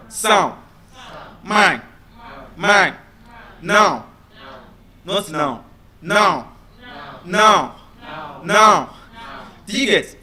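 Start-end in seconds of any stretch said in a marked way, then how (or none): 0.1-4.1 SOUND, MINE, NOW pushed through the nose; isolated nasal demo of SOUND, MINE, NOW; nasal words. Shown as a demonstration. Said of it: "you have to use your nose". 6.0-8.9 NOW repeated with a nasalised vowel; emphatic nasal NOW for repeating; nasal pronunciation. Shown as a demonstration. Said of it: "you have to use your nose".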